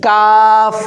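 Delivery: কাফ pronounced loud, tongue further forward on the palate; Arabic letter kaf. Shown as a demonstration. Teacher demonstrating kaf to adult learners, loudly. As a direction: hit কাফ cleanly off the palate. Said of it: "খেয়াল করেন".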